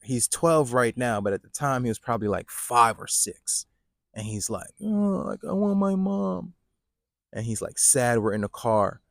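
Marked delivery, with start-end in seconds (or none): 4.8-6.5 put-on high, sad child's voice; whiny little kid. Five-year-old son in the car missing mom, high sad child voice.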